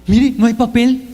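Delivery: loud complaint to dad; hammed-up boy's demand; insistent child. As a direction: insist on the problem, raising your voice. Son calling from the toilet.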